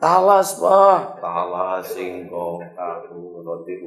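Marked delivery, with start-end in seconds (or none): 0.0-1.2 high, loud announcing; the boy showing his father what he got; excited child. Acted. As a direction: show off the food with delight. Boy shows the food.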